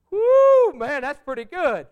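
loud, high whoop, then a slow, loud "man, that's pretty good"; ecstatic whoop. Retold or imitated. Driver overwhelmed by the song, whooping loud and high. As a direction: let the joy burst out.

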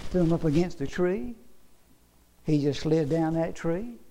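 ordinary delivery

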